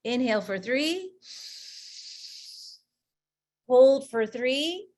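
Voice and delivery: slow counted breathing cues; guided breathing. Shown as a demonstration. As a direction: pace their breaths, calm and slow. Leads a breathing exercise.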